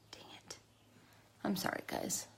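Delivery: hushed, fighting back tears; choked whisper. Real, not acted. Apologizing to viewers while holding back tears, hushed whisper. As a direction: whisper it, trying not to cry. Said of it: "I don't wanna cry".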